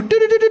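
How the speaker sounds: high-pitched techno "do do do"; imitated techno beat. Comic mimicking techno for the audience, high imitated synth. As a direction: sing out a fast synth riff.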